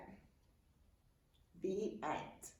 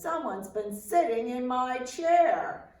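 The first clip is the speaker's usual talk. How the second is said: Papa Bear voice; 4 semitones higher, 6 dB louder than usual. A narrator's reading. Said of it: "and Papa Bear says".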